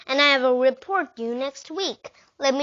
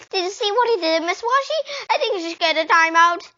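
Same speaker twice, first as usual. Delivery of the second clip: high, loud whining tattle; whiny tattletale; 8 semitones higher, 5 dB louder, faster than usual. A narrator's reading. Girl whining to the teacher about the boy who scared her. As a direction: whine it up to the teacher like a wronged little girl. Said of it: "and whined".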